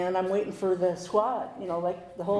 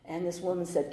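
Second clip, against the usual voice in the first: ordinary talk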